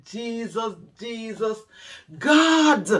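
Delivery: high desperate wail rising to a shout; crying out to God. Retold or imitated. Retelling her anguish at the ruined shop, crying out to God. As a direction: call on Jesus, breaking into a cry. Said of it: "I said".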